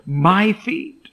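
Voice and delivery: high, loud incredulous question; surprised. Acted. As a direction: ask in astonishment, voice climbing on "my feet". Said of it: "Peter's surprise".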